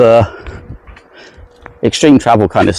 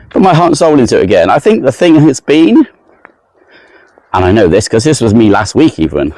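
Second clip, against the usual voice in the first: usual speaking voice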